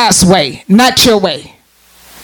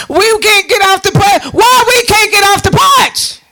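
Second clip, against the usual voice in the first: mock childish whine, pitched up; whining children; 7 semitones higher than usual. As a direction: kids protesting they can't leave the porch. Children on the porch complaining.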